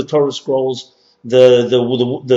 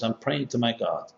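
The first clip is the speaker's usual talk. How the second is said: quiet; 2 semitones lower, 11 dB quieter than usual. Retold or imitated. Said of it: "begins to mumble something quietly".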